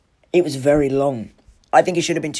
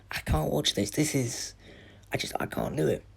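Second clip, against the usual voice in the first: hushed, overwhelmed; 9 dB quieter than usual. Retold or imitated. Retelling being too upset to watch.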